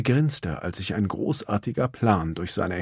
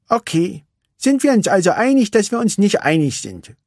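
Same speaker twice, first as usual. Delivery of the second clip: put-on higher, louder girlish complaint; sulky little racer; Vanellope; 10 semitones higher, 4 dB louder than usual. A narrator's reading. Vanellope complains to Ralf, higher and sulky.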